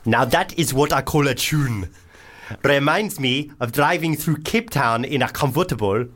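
put-on full South African accent, overdone. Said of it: "is this going full African?".

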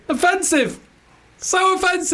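very high squeal through laughter; gleefully scandalised, high and laughing; laughing protest. Real, not acted. Cohost protesting his friend's accent trick, laughing.

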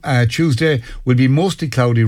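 ordinary delivery

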